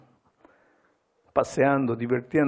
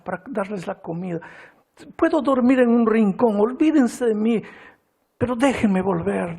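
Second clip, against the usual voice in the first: high, pleading cry; tearful pleading; 10 semitones higher than usual. Retold or imitated. Wife begging her husband and children, high tearful plea. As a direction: beg them to take you back.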